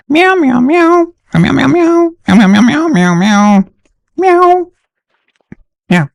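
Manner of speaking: high squeaky kitten meows; high, playful kitty meowing; playful cat meows. Pretend. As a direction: meow like a kitten for a child. Meowing for his daughter.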